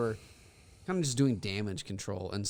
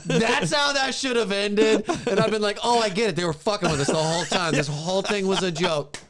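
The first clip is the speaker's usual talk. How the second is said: louder and higher, laughing through it; laughing rant; 8 semitones higher, 7 dB louder than usual. Real, not acted. Cracking up over how the film should have ended, loud and laughing. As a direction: howl it out between laughs.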